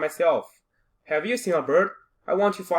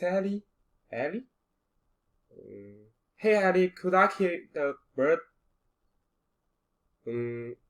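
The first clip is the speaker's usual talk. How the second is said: ordinary talk, nothing marked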